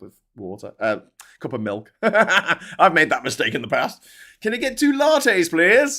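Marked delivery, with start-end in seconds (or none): 4.4-5.9 high, loud caricature of a British tourist; stupid British accent. Retold or imitated. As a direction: ham up a silly British accent, pitched way up. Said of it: "in his stupid British accent".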